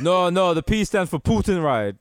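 mock Russian-accented driver. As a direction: correct the rider in a Russian accent. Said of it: "they have your accent".

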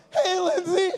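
high, wailing nervous greeting; nervous possessed voice. Acted. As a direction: panic and lose control of your voice. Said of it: "I sound like I am possessed".

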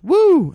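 high, loud whoop in mimicry; imitated woo. Comic mimics lady at the bar, high loud woo. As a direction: imitate the returning heckler's woo. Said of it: "she goes".

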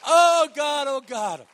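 high, loud cry of praise; ecstatic cry. Real, not acted. Pastor overwhelmed in worship, ecstatic cry. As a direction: cry out to God in rapture.

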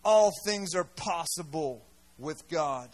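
slow, lightly voiced angel, low and measured; a hint of a gentle heavenly messenger, slowed down; angel's words. Angel speaking to Mary, slow and low. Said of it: "The angel spoke to her and said".

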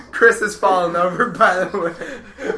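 loud, laughing through the words; helpless laughter. Real, not acted. Friend tells listeners Chris is falling over, laughing hard. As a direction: report it while cracking up.

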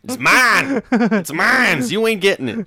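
shouting it's mine, high and loud; possessive whining. Acted. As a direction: clutch the paycheck and yell it's mine. Husband hoarding paycheck, mock.

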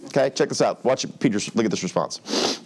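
ordinary delivery

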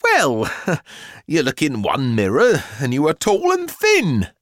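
high, chuckling mother voice; lively, amused mother; jolly mother. A narrator's reading. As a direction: explain it brightly through a little laugh.

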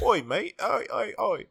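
high, loud mock Cockney "oi"; hammed-up Cockney shout. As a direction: bellow like a cartoon Cockney geezer. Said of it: "You coulda just went real Cockney".

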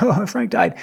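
hammed-up cry of grief; mock wail. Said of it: "And I, I cried".

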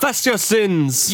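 put-on high, drawn-out Jamaican accent; slow, hammed-up Jamaican-style decree; mock Jamaican accent. Co-host echoing the attacker impression, mock Jamaican accent. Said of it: "that sounds less Jamaican and more Irish".

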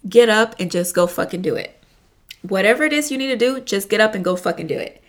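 normal talking voice

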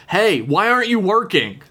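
mock scolding. Acting someone demanding to know about work, mock scolding. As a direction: call someone out, playfully accusing.

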